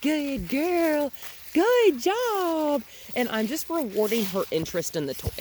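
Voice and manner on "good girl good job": much higher and louder, slow praise; happy praise. Real, not acted. Trainer praising the puppy for chasing the toy. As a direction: cheer her on.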